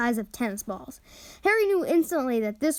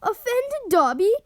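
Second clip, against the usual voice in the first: high, louder choked cry; 9 semitones higher, 4 dB louder than usual. A narrator's reading. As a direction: squeeze it out through tears. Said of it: "choked the elf".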